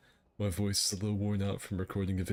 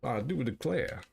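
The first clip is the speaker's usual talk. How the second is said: light southern gentleman voice; old southern gentleman; 3 semitones higher than usual. Acted. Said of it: "talk like an old southern slave owner".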